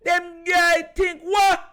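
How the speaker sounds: mock Jamaican accent, slow and loud on the last part; lightly voiced Jamaican taunt; playful Jamaican patois challenge. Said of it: "Lamar turned Jamaican".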